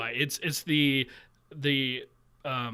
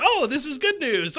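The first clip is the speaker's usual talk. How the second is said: much higher, pleasantly surprised quote; mock relieved surprise; 9 semitones higher than usual. Finding the friend's text is good news, acted relief. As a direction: flip into exaggerated happy relief.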